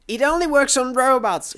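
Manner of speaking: very high-pitched frantic cry; exasperated scream. A narrator's reading. Companion explaining the useless grenade, exasperated scream. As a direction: scream it at a foolish partner. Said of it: "she screamed at me".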